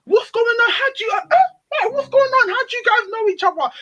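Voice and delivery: acted-out question in a high voice; high, put-on innocent questioning; high-pitched mock surprise. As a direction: squeal it like you have no idea. Acting out a feigned-innocent question.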